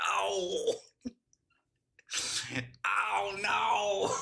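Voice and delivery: high, hammed-up yelp of hurt; mock pained cry. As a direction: play a fake wounded "ow".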